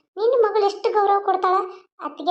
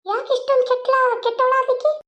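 higher, indignant disbelief; shocked outrage; 6 semitones higher than usual. Acted. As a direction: cry out how bad she has become. Mother-in-law scolds the daughter-in-law.

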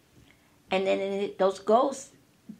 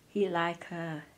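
softer, lower, a sly aside; low insecurity voice; 3 semitones lower, 7 dB quieter, slower than usual. Retold or imitated. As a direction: tempt her quietly with the doubt. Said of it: "some just whisper".

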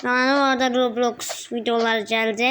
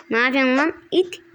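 usual speaking voice

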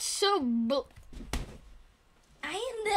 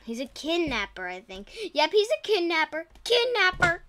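normal talking voice

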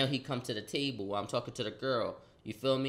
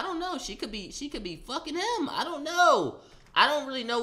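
incredulous; 11 semitones higher, 4 dB louder than usual. Real, not acted. Laughing disbelief.

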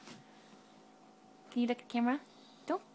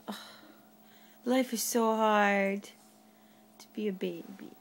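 put-on slow, lower, sighing baby complaint; mock baby lament; 6 semitones lower, much slower than usual. Mom voicing the toddler's complaint.

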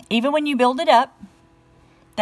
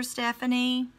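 ordinary delivery

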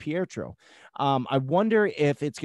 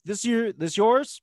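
quoted surprise; 10 semitones higher, 3 dB louder, slower than usual. Retold or imitated. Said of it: "I'm like".

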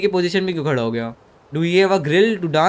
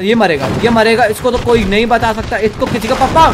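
loud, raised, urgent commentary; excited shouting; 5 semitones higher, 6 dB louder than usual. Real, not acted. Gamer watching the chase go wrong, excited shouting.

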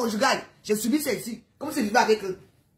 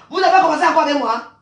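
loud, fast burst of defiance; angry warning; 3 semitones higher, 9 dB louder, faster than usual. Real, not acted. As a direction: raise your voice and warn the neighbours off. Real warning rant at the neighbours.

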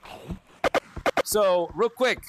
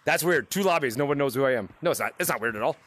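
usual speaking voice

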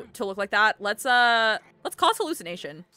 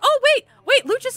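much higher and louder sudden cry; excited; 10 dB louder than usual. Real, not acted. Spotting Lucha still at the party, an excited squeal. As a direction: burst out as if you just spotted him.

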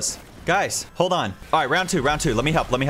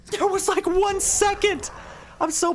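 squeaky voice. Real, not acted. Squeaky disbelief at the timer.

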